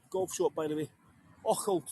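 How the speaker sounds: ordinary delivery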